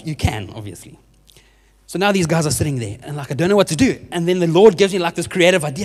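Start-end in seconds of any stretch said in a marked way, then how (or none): none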